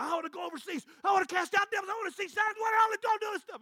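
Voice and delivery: high, shouted bragging; boastful shout. Retold or imitated. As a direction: yell out the big ambitions. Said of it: "When people start making".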